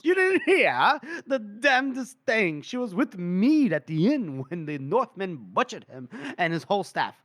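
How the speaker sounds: high, swooping tipsy storytelling; boisterous drunk. Acted. Drunk Felnik telling officers of Finri, high and boisterous. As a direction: relish the gossip like a hammed-up drunk. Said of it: "he's just drunk and just like".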